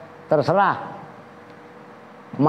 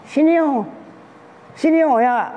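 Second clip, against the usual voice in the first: high coaxing tomcat call; 11 semitones higher than usual. Acted. Preacher voicing a tomcat wooing the female cat. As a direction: lure her in like a tomcat. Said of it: "Kan kata kucing, kucing jantan".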